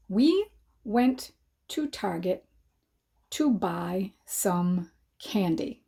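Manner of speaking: slow, word by word as if dictating; slow, careful dictation to the class. Shown as a demonstration. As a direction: spell it out slowly for young writers. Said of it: "the sentence I'm going to write today".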